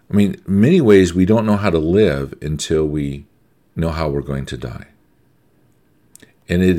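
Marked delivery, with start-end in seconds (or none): none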